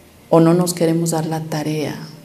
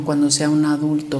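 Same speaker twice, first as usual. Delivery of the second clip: ordinary talk, nothing marked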